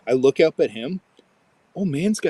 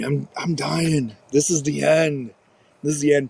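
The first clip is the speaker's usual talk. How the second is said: put-on dramatic dying boy; 2 semitones higher than usual. Injured son telling dad he is dying, mock-dramatic. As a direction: play the dying hero, hammed-up. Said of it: "He's telling me".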